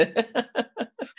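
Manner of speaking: light, rippling laugh. Real, not acted. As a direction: laugh at your own remark. Laughs after her own joke.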